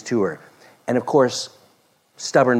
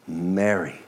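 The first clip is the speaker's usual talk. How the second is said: softer and lower, gently; tender; 6 semitones lower, 4 dB quieter than usual. Acted. Jesus tenderly calling Mary's name, softly. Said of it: "So beautiful, so kind, so tender".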